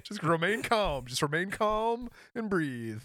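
slow, measured self-soothing; calming self-talk. Retold or imitated. Retelling how he calmed himself during the back twinge, slow and soothing. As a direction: talk yourself down slowly. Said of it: "I was like".

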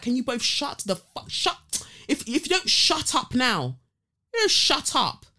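heated, loud demand for silence; retold angry telling-off. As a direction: re-enact snapping at noisy neighbours. Retold confrontation with noisy couple.

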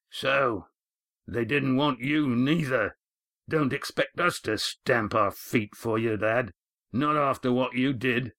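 slow, grudging skipper voice; a resentful weathered sea captain; bitter old skipper. A narrator's reading. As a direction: scorn the lad like a disappointed captain. Skipper voiced through the scene.

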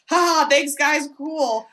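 forced high, nervous little laugh and thanks; acting normal. Sweating Jake thanks his frat brothers, feigning normal. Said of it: "trying to act normal".

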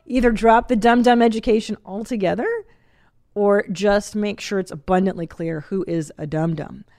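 ordinary delivery

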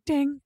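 quick high message-tone ding; imitated text alert. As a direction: mimic a phone notification.

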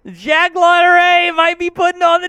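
high, giddy holler; excited announcement. Real, not acted. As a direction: call it out high, thrilled it may happen. Announcing stunt may happen.

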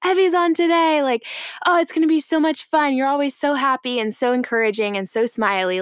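excited coworkers. Retold or imitated. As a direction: cheer like happy coworkers. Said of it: "would be like".

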